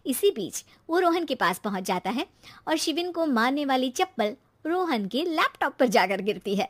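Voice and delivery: plain reading voice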